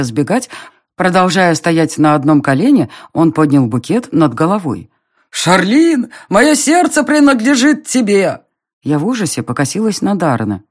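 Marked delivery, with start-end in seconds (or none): 5.3-8.4 high, drawn-out suitor's cry; lovestruck declaration. A narrator's reading. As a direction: declaim love like a melodramatic serenader. Пылкий поклонник признаётся в любви. (пылкое, высокое признание влюблённого)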